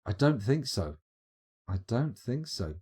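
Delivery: usual speaking voice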